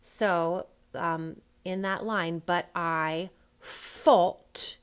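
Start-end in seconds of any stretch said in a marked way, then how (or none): 2.4-4.8 thought said as FOUGHT, th turned to f; drawn-out, loud Cockney "fought". Shown as a demonstration. Accent coach demonstrating the line to an actor, Cockney th-as-f. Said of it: "first one on thought is an F".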